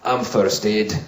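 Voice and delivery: low, slow hard-man voice; tough first aider. Acted. First-aid guy taking over, low tough-guy voice. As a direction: massive bloke calmly taking charge. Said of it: "Massive guy, bald, shaven head".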